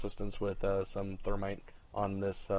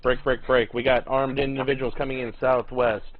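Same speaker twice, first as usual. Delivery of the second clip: urgent shouted callout; 4 semitones higher, 8 dB louder than usual. Real, not acted. Urgent contact report over radio.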